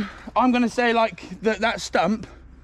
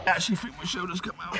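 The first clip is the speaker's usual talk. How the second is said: ordinary delivery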